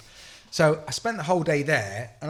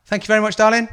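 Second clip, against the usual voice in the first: high, loud, sugary mock thanks; higher and louder mock-polite farewell; sarcastic thanks; 10 semitones higher, 9 dB louder, faster than usual. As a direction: sing it out with fake sweetness. Sarcastic goodbye to the shopkeeper.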